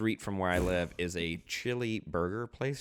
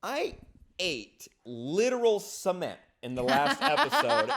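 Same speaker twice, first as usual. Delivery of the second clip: usual speaking voice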